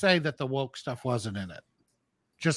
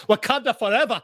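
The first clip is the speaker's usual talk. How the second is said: higher, put-on Wakanda accent; jokey, pitched-up rallying cry; mock film catchphrase; 6 semitones higher than usual. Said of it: "She would have came in and go".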